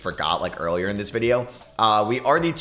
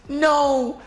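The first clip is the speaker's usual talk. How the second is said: anguished no; 3 dB louder than usual. Real, not acted. Player stripped in final seconds.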